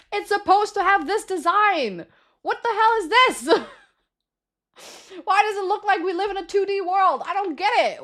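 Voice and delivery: high-pitched, laughing tirade of disbelief; exasperated outrage. Real, not acted. Streamer tears into the square design for viewers, exasperated. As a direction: rant high, in total disbelief.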